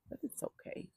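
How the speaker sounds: soothing whisper. Real, not acted. Trainer whispering comfort to her scared horse, whispered. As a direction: breathe it to calm him.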